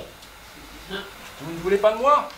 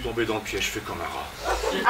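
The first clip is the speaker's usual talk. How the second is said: defeated self-mockery; 8 semitones lower, 6 dB quieter than usual. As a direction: sink into defeat with a bitter chuckle. Despairing lover caught in trap.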